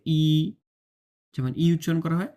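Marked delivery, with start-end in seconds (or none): 0.1-0.5 "ই" held as ee with a slight gap; "ই" through narrow gap. Shown as a demonstration. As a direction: raise the tongue for a long "ই". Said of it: "হালকা করে একটু জায়গা বের করে দিয়ে". (জিহ্বা উপরে তুলে "ই")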